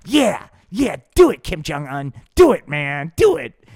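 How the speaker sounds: loud goading cheer; loud taunting cheer; egging on. Pretend. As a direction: egg them on at full volume. Weed pusher eggs them on.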